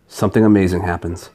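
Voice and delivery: usual speaking voice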